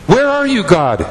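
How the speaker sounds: high, pleading cry to God; desperate high plea to God; anguished plea. Acted. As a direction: cry it up to heaven, then drop.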